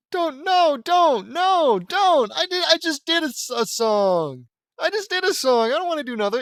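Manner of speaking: very high, loud wail of complaint; high-pitched protest. Real, not acted. Reader dreads having to do another song, high loud protesting. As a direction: plead loudly at the top of your range.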